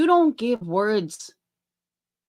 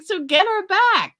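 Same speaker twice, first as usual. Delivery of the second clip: high, louder mock exclamation; pitched way up and punched; emphatic retold urging; 11 semitones higher, 4 dB louder than usual. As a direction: retell it as a raised, insistent urging.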